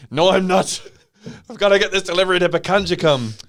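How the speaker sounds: high, loud, hurried protest; defiant trucker. Acted. Trucker arguing with officer about his delivery, loud defiance. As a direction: protest loudly and fast, insisting on the mission.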